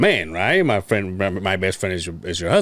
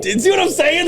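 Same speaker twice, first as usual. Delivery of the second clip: excited; 13 semitones higher than usual. Retold or imitated. Retold reaction to dad's joke.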